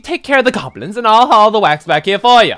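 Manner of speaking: little sidekick voice. Pretend. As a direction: play the eager little helper. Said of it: "I don't know what type of voice to do it for".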